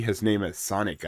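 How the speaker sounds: usual speaking voice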